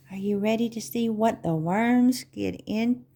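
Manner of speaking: plain reading voice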